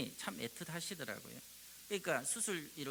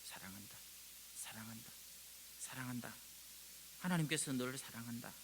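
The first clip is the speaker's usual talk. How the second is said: very soft, lower, tender repeated words; tender whisper; 4 semitones lower, 7 dB quieter than usual. Pretend. Spirit at the sickbed, soft tender whispered love. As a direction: hush it like a lullaby by the bed. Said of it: "침상을 지키며 기도하시는 거죠".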